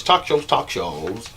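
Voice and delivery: mock British talk shows; British vowels on talk shows; British accent. Shown as a demonstration. Mock British voice for the viewers. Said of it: "That's my British voice".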